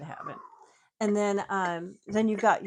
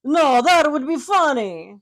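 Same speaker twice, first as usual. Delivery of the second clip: loud, higher, slow hammed-up Italian accent; 6 semitones higher, 11 dB louder than usual. Shown as a demonstration. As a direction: belt it in a big Italian accent. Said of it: "So that would be my Italian accent".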